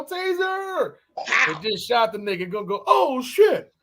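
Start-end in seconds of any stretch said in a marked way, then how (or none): 0.0-1.0 mock cry. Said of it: "Y'all seen the video". 2.9-3.7 mock surprise. Imitating shocked officer on video.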